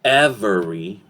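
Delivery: "every" as EV-er-ee, middle e sounded; syllable by syllable. Shown as a demonstration. Teacher showing what to avoid, "every" syllable by syllable. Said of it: "syllable by syllable".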